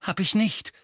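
put-on indignant, quick-to-object boy; sharp denial. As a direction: deny it sharply. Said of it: "sagte Harry scharf".

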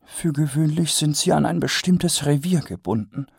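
a hint of a lecturing lady; Lady Ramkin explaining; lightly voiced lady. A narrator's reading.

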